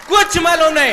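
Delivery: loud, high outburst; emphatic exclamation. Real, not acted. Speaker tells audience they knew nothing at all, emphatic. Stresses total lack of knowledge.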